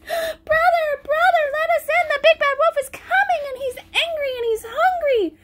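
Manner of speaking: high frightened pleading; panicked pigs. Acted. Little pigs begging their brother, frightened. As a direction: beg to be let in.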